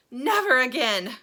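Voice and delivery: gasped. A narrator's reading. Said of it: "gasped Missus T V".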